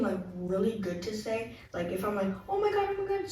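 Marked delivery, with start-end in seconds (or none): 2.5-3.3 high-pitched "oh my God" gushing; excited gushing. Retold or imitated. Imitating herself gushing excitedly to friends. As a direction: gush it out, thrilled. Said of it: "super excited to say it".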